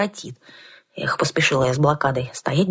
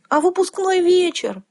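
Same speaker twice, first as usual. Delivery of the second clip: wailing; slower than usual. A narrator's reading. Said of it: "возопила я ещё горестней".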